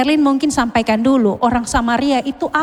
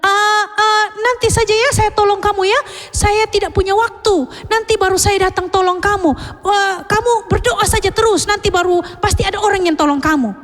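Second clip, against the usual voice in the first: dismissive priest; 7 semitones higher than usual. Acted. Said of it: "imam bilang".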